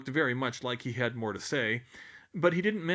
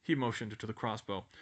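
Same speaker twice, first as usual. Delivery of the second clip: plain reading voice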